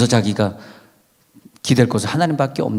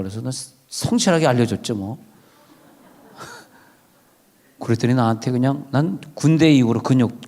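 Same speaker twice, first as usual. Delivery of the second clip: ordinary talk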